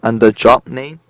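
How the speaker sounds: ordinary talk, nothing marked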